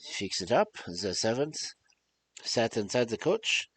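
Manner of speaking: storytelling in a put-on French-German accent; muddled French-German accent. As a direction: read the tale with a hammy continental accent, French sliding into German. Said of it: "French accent when this is supposed to be German".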